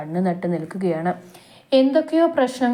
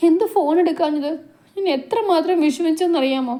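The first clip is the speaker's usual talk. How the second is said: higher, tearful pleading; girl crying to her beloved, higher tearful voice; crying reproach; 9 semitones higher than usual. A narrator's reading. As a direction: scold him through tears. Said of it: "അതും പറഞ്ഞുകൊണ്ട് ലച്ചു കരഞ്ഞു".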